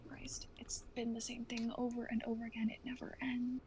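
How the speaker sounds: hushed, worn-out whisper; whispered despair. Acted. Vaughn collapsing under the endless fighting, whispered despair. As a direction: breathe it out, defeated.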